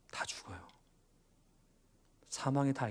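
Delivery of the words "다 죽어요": very soft, almost voiceless solemn warning; hushed warning. Real, not acted. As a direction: drop to a near whisper, grave. Grave warning to the congregation. (속삭이듯 낮춘 엄중한 경고)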